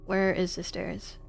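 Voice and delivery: ordinary delivery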